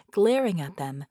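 ordinary delivery, nothing marked

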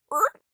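short, high-pitched squawk; startled squawk. A narrator's reading. Vicky jolted as she falls, squawking. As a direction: let out a sharp little squawk. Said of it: "she squawked".

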